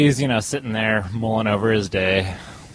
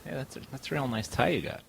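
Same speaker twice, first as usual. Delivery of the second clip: little voice; 4 semitones higher, 12 dB quieter than usual. Retold or imitated. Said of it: "this little voice that goes".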